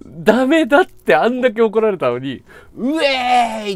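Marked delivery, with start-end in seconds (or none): 2.8-3.7 loud cheering "ウェーイ"; party whoop. Retold or imitated. Sano cheering atop the shrine, loud whoop. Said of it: "って言って".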